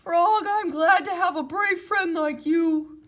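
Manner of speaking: Toad voice. Acted. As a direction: thank a brave friend as Toad. Toad's character voice.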